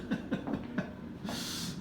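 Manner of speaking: quiet giggle after cracking up; laugh. Real, not acted. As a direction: giggle at your own failed attempt. Cracks up at failed accent.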